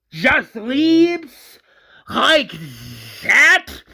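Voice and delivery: high, loud outraged cry; Johnny shouts that his sister abandoned him; anguished yell. Acted. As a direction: shout it in disbelief. Johnny yelling about sister.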